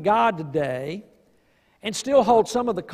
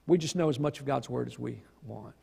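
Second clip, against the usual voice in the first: low, soft aside; 8 semitones lower, 9 dB quieter than usual. Real, not acted. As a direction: lower and softer, conceding. Quiet conclusion after a laugh.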